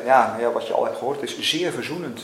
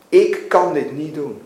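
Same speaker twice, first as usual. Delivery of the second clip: plain reading voice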